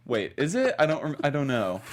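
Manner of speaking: usual speaking voice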